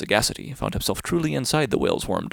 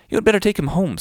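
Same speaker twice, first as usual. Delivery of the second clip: much higher, louder urging; fish urging; 9 semitones higher, 6 dB louder than usual. Acted. The fish urges the whale home, higher and louder. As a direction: press the advice high and loud.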